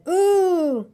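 high-pitched "ooh" on the way up; jolted "ooh". A narrator's reading. Piglet tossed up in Kanga's pocket, high jolted ooh. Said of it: "as he went up in the air".

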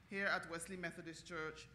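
ordinary talk, nothing marked

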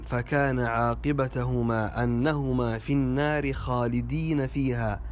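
"خالدين" as khalidina; plural vowel. Shown as a demonstration. Teacher shows a common mistake, "خالدين" said khalidina. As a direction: say "خالدين" with long i, ending na. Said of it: "وهذا خطأ".

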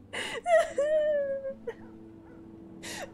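long high cry-laugh; rising high sobbing laugh; tearful laugh. Real, not acted. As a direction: laugh until it turns to crying.

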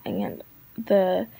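ordinary delivery

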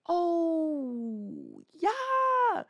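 long groaned "oh", then squeaky "yeah"; stretched, strangled mock approval; hammed-up forced enthusiasm. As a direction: imitate badly faked delight, voice leaping up. Repeats his dismayed reply, exaggerated.

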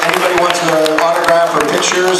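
loud upbeat stage announcer thanks; host's loud closing; emcee sign-off. Real, not acted. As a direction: wrap up the show for the crowd.

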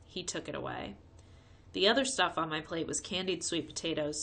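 plain reading voice

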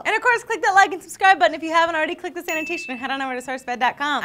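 fast, loud subscribe pitch; rushed plug. Real, not acted. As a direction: rattle off the plug quickly.

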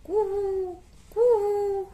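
high cuckoo coo-hoo; cuckoo call. Shown as a demonstration. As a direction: call like the bird in the mango trees. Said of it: "पेड़ों पे". (ऊँची आवाज़ में कोयल की कूहू)

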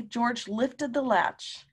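ordinary delivery

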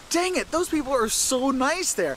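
high, slow, drawn-out gushing; gushing quote. Retold or imitated. Wife gushing about the nice Southern people, high and slow. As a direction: stretch it out, starry-eyed.

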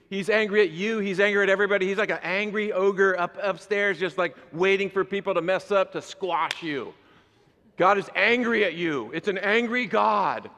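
high, loud, worked-up caricature; mock zealous rant. Pastor mimicking a listener who wants wrath, loud and high. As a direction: play the eager angry-God fan. Mocking believer in an angry God.

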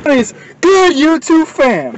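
high, slow channel greeting; vlog intro. Acted. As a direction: call out to the fans like a host. Second take of the intro.

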